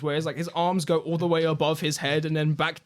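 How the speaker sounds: usual speaking voice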